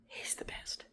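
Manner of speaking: breathy reverent whisper. Real, not acted. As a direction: whisper it like a sacred secret. Reverent aside about father.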